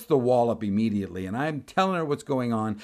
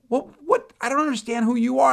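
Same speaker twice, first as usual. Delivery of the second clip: high, loud, baffled questioning; bewildered; 14 semitones higher, 7 dB louder than usual. Retold or imitated. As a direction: act out a clerk who is utterly confused. Bewildered clerk questioning him.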